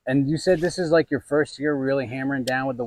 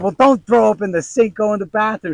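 higher, louder urgent order; loud retold order to his wife; urgent telling-off; 7 semitones higher, 5 dB louder than usual. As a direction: hurry her away from the sink.

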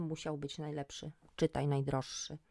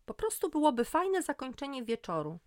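higher, louder protest; defensive insistence; 8 semitones higher, 5 dB louder than usual. A narrator's reading. Magda defends her beach idea to her friend, defensive. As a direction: insist it's just a nice idea.

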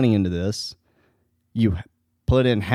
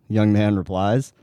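usual speaking voice

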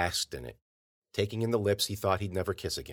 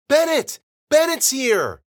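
much higher and louder, calling out; joyful shout; 12 dB louder, slower than usual. A narrator's reading. Friends in the valley shout happily to Bennett. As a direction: call across the valley in delight. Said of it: "they cried".

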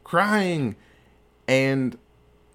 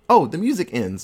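usual speaking voice

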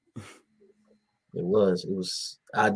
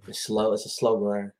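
usual speaking voice

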